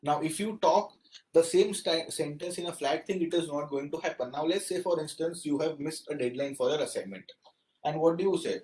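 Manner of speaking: ordinary talk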